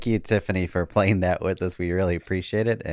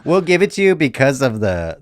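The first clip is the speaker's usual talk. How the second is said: laughing; 9 semitones higher, 8 dB louder than usual. Real, not acted. Laughing after a funny answer.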